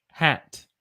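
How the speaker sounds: "hat" with a short a, as in apple; clear, isolated "hat". Shown as a demonstration. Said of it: "the hat has a short A sound".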